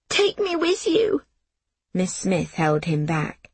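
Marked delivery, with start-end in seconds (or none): 0.1-1.2 put-on high, wailing child's cry; howling plea. Little Jamie howling to go with the train. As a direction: wail it out like a desperate little boy. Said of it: "Jamie began to howl".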